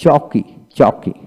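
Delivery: চকি drawn out slowly, syllable by syllable; slow demo. Shown as a demonstration. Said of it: "তারপরে". (ধীরে ধীরে চকি)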